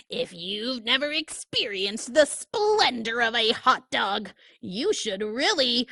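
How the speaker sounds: slow, pompous pigeon praising food; grand pigeon. Acted. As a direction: savour every word like a food critic. Pigeon boasts about hot dogs.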